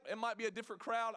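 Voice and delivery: ordinary talk, nothing marked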